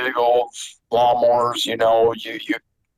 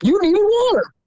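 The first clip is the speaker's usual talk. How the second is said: mom's retort. Retold or imitated. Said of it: "My mom looked at him and said".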